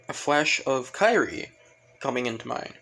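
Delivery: ordinary delivery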